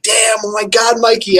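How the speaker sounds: retold thrilled outburst; excited shout. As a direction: yell his name in the thrill of the catch. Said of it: "and I'm like".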